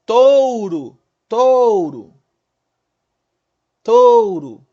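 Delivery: "touro" bem forte, O esticado e agudo, caindo no fim. Shown as a demonstration. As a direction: sustente o O de "touro". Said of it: "Qual que é a vogal mais forte aqui?". ("touro" com a primeira sílaba enfática)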